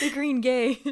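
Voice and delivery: loud laughing repeat. Real, not acted. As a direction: say it cracking up. Repeating joke while laughing.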